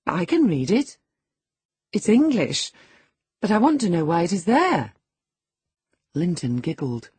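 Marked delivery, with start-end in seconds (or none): 0.1-4.9 put-on higher, louder proud girl; brightly superior young girl; Catherine, higher girlish voice. A narrator's reading. Catherine showing off to Hareton, higher girlish voice. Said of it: "cried Catherine".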